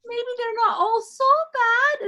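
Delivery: high, cooing character voice; softened motherly voice. Acted. Odelia won over by the hugging redcap, high cooing. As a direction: coo it hopefully, high.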